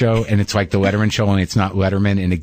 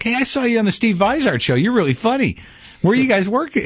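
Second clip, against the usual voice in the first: much higher, chirpy fan voice; eager waiter; 14 semitones higher than usual. Retold or imitated. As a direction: gush like a starstruck waiter. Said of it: "the waiter comes up and is like".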